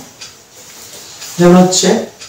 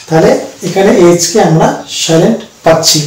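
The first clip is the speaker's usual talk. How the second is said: usual speaking voice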